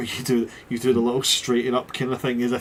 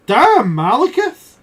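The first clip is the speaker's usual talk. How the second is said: loud, higher, slow, drawn-out damn; impressed exclaim; 11 semitones higher, 11 dB louder, slower than usual. Acted. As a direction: let it out, really impressed.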